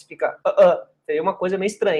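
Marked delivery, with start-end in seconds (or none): none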